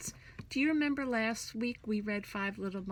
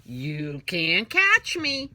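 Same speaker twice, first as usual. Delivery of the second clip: teasing; 7 dB louder, much slower than usual. Acted. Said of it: "Tease Mister Crocodile".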